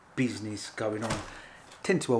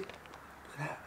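whisper; 4 semitones higher, 14 dB quieter, much slower than usual. Real, not acted. Admiring the box.

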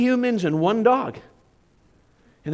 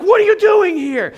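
high, loud startled yell; a son's frightened shout at a shadowy figure; retold startled scream; 12 semitones higher, 9 dB louder than usual. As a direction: shout it as if caught off guard in the dark. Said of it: "like, screamed".

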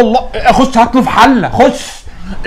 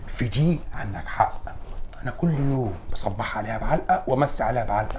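hushed agreement; 7 semitones lower, 11 dB quieter than usual. Acted. Quiet agreement with father.

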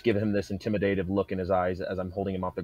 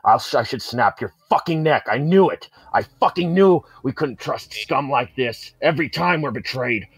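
louder, rising furious threat; enraged threat; 5 semitones higher, 7 dB louder than usual. Acted. Warrior threatens the traitor he holds aloft, furious and loud. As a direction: crush him with every word.

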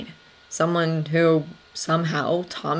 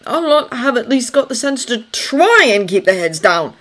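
put-on higher, louder, worked-up young man running on; agitated Thomas; 6 semitones higher, 6 dB louder than usual. Thomas telling Anna of friends at war, agitated. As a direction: keep going, voice raised and upset.

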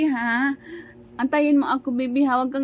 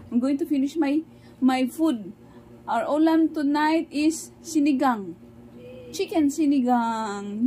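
ordinary talk

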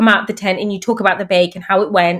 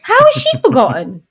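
mock disbelief; 9 semitones higher than usual. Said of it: "I was like".

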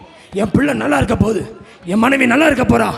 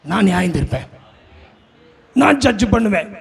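God the Father speaking; 5 semitones lower than usual. Acted. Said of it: "அப்பா சொல்றாரு".

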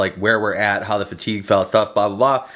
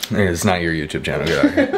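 mock stern; 9 semitones lower, faster than usual. Mock shooing friend.

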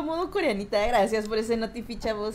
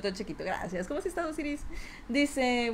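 ordinary talk, nothing marked

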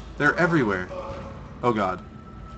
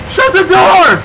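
higher, louder panicked yelling; frantic shouts mid-escape; panicking gamer; 11 semitones higher, 11 dB louder than usual. Real, not acted.

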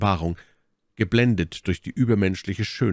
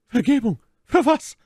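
sehr hoch und lauter; verblüfft und fordernd zurückfragen; erstaunte Gegenfrage; 6 dB louder than usual. Acted. Milady fragt scharf zurück. (erstaunt, mit hoher Stimme nachfragen)

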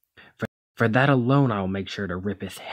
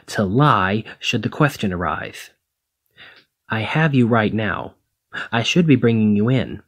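louder, heated scolding that keeps building; angry rant; 4 dB louder than usual. Acted. Hero scolding the thief for putting her in this position, angry and loud. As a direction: let your frustration boil over at them.

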